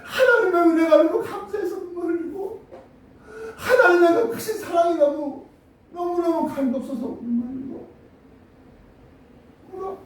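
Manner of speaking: choked up with gratitude; tearful. Real, not acted. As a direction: voice breaking with emotion. Preacher moved describing God's love.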